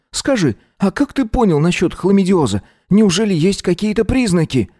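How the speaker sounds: high, curious sidekick voice; curious partner. A narrator's reading. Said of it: "Внезапно полюбопытствовал напарник".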